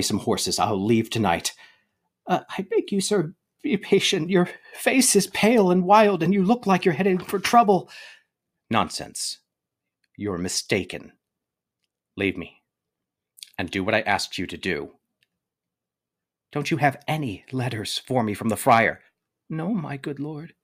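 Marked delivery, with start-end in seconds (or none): 2.3-7.9 higher voiced nervous servant; a timid servant delivering grief; young servant bearing bad news; servant Balthasar. Balthasar pleads with Romeo. 19.5-20.5 higher voiced nervous servant; a timid servant delivering grief; young servant bearing bad news; servant Balthasar. Balthasar answers softly.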